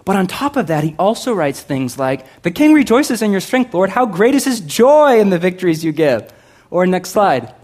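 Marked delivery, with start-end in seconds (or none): 2.4-6.3 high, loud rejoicing; joyful reading. Acted. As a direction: declare it with triumph.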